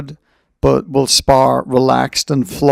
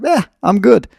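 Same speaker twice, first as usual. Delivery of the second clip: higher, breezy dismissal; mock lazy shrug; 9 semitones higher than usual. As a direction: brush it off like nothing matters. Imagined lazy self again.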